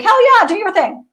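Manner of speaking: a high, loud cheer; excited cheer. Retold or imitated. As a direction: hype her up: hell yeah. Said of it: "I was like".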